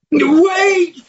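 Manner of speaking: loud desperate high call. Acted. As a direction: shout after her as she leaves. Frog calls after departing princess.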